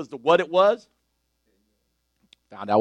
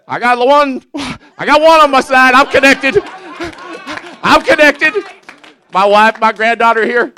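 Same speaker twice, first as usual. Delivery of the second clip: higher and louder, fired-up sermon; full-force preaching; 10 semitones higher, 10 dB louder than usual. Acted. Preacher lifting the church, fired-up preaching. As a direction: preach it out with rising fire.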